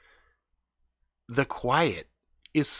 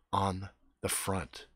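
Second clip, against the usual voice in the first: hushed spooky telling; 5 semitones lower, 6 dB quieter than usual. A narrator's reading. Telling creepy noises in hushed voice.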